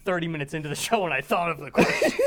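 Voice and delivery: laughing while talking; barely holding back laughter; cracking up mid-line. Real, not acted.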